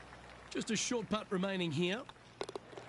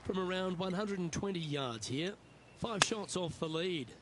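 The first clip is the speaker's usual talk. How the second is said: golf commentator; 4 dB quieter than usual. Acted. Golf broadcast play-by-play.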